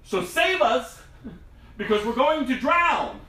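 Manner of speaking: half-playful cry for help; preacher echoing the disciples' cry for help; mock panic.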